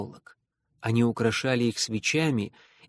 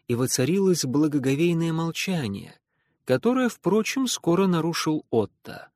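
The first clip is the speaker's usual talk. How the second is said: usual speaking voice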